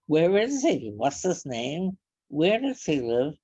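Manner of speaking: loud, high and excited, firing questions; a child suddenly thrilled to learn she has her own father; little girl's eager questions. Retold or imitated. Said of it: "And she".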